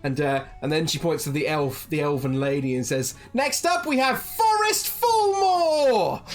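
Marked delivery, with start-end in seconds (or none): 3.3-6.2 high, slow, drawn-out announcer call; innkeeper calling out. Acted. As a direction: sing it out to the whole tavern like a ring announcer.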